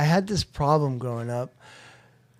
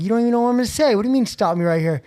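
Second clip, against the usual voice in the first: higher and louder, offended comeback; indignant retort; 8 semitones higher, 7 dB louder, much faster than usual. Retold or imitated. To his brother who cut him off, indignant and louder. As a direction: push back, stung and defensive.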